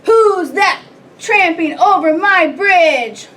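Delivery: huge drawn-out troll challenge; roaring troll. A narrator's reading. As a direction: bellow it out as an outraged troll. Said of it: "roared the troll".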